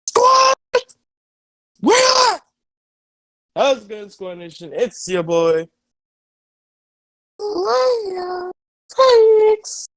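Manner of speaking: high, loud YouTuber hype; hyped intro greeting. Acted. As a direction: shout the channel greeting.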